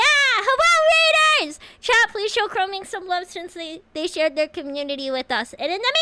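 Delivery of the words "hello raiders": high loud greeting; excited welcome. Real, not acted. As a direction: cheer the raiders in. Greeting raid.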